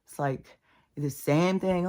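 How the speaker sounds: normal talking voice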